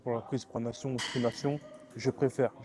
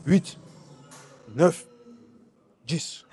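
louder count as the set gets hard; mid-set effort, louder; straining reps; 4 dB louder, much slower than usual. Real, not acted. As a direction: force the numbers out under load. Straining through last reps.

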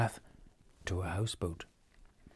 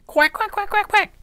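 very high quacking duck voice; 7 dB louder than usual. Acted. Ping the duck crying out while held tight, high quacks. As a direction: quack like a frightened duckling. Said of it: "cried Ping".

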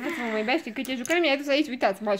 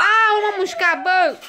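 high, loud cry of ouch; yelping protest; 7 semitones higher, 10 dB louder, slower than usual. Real, not acted. Nipped by the puppy, loud high protest. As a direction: cry out as the puppy nips you.

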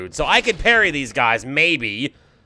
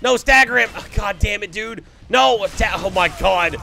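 ordinary talk, nothing marked

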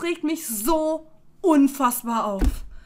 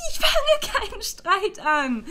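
slow, laughing protest; disbelieving laugh; 4 semitones higher, slower than usual. Real, not acted.

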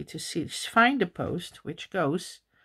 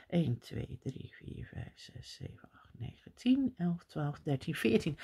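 quietly counts stitches in Dutch, fading to a murmur; counting in Dutch; 9 dB quieter than usual. Real, not acted. Crocheter counting stitches to herself, soft Dutch murmur. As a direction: keep count under your breath in Dutch. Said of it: "Dutch, as you know from my accent".

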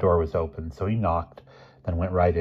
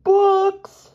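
very high, loud squeaky wail; sobbing; 8 dB louder than usual. A narrator's reading. Expelled boy to his roommate, high squeaky sob. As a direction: wail the word in despair. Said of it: "sobbed Elmer".

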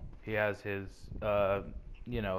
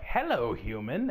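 Papyrus impression. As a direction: squeak out a silly skeleton hello. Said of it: "he's just like".

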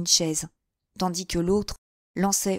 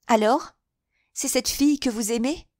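said painfully; 6 semitones higher, 3 dB louder than usual. A narrator's reading. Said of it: "dit-elle péniblement".

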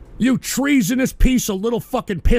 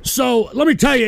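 usual speaking voice

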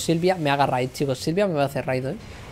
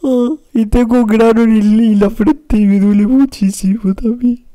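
mock-crying wail; 4 semitones higher, 8 dB louder than usual. Streamer moaning about a sore forehead pimple, loud mock wail. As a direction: bawl it out in exaggerated self-pity.

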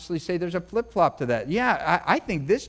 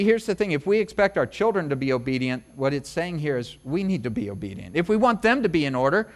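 ordinary talk

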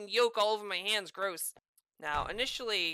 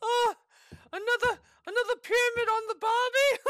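high giddy punchline; gleeful shout; 13 semitones higher, 7 dB louder than usual. Real, not acted. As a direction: crow it out with glee. Gleeful joke after kill.

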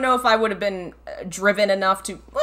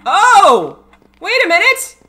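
loud, high burst of surprise; startled realization; 12 semitones higher, 11 dB louder, slower than usual. Real, not acted. Gamer suddenly noticing something in the game, startled exclamation. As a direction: cry out as it suddenly dawns.

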